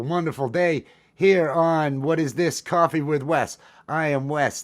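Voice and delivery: loud, high-pitched host greeting; high-energy show opener. Real, not acted. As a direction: belt out a rousing welcome to the audience.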